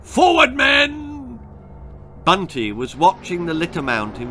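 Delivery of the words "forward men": much higher, louder barked command; barked order. A narrator's reading. Colonel ordering his men forward, barking loudly. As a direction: bark it like a colonel leading a charge. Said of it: "Then he barked".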